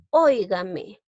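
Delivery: "Óigame" with ÓI punched; teacher showing the strong start of "Óigame"; stress on first syllable. Shown as a demonstration. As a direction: hit the opening ÓI of "Óigame". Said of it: "Lleva la mayor fuerza de voz".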